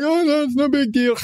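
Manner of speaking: high-pitched mock idiot shrugging it off; generic idiot voice. Podcast host mocks the man eating raw pork, generic idiot voice. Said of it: "my default idiot voice".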